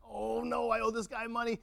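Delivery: hammed-up high-pitched dismay; mock dread. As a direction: play a debtor dreading the encounter. Debtor dreads the man he owes.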